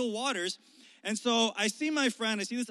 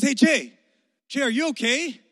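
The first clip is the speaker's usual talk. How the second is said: loud, raised call across the water; 7 semitones higher, 11 dB louder than usual. Retold or imitated. Friend calling out to a swimmer in trouble, loud and raised. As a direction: shout over to a friend who is struggling.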